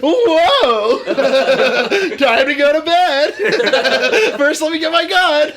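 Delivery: high, loud, laughing panic cries; mock streamer freaking out, laughing; hammed-up gamer scream.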